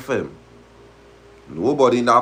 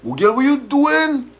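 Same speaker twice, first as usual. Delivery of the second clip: high, startled cry of the caught man; mock outraged shout; imitating boyfriend yelling; 13 semitones higher than usual. Boyfriend catches girlfriend keying his car, mock shouting.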